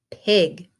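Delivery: ordinary delivery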